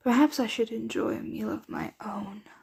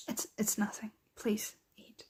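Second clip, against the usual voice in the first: subdued brush-off; 8 dB quieter than usual. Acted. Brushing off their own upset.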